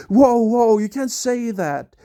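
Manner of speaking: mock alarm, reining a friend in. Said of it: "that was the tone".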